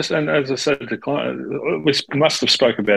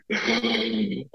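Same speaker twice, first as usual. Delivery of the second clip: sharp gasp of realization; 12 semitones higher, 6 dB quieter than usual. Retold or imitated. Moment the swing finally clicks.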